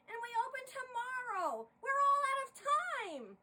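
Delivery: loud, high, frantic tirade; a director melting down the night before opening; ranting director. Acted. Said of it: "attempted to simmer down her bubbling stew".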